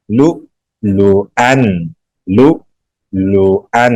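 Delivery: "لؤلؤا" slowly, broken by an abrupt glottal stop; hamza with jolt. Shown as a demonstration. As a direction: cut the voice sharply at each hamza in "لؤلؤا". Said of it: "جھٹکا لگائیں گے".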